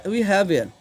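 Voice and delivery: ordinary delivery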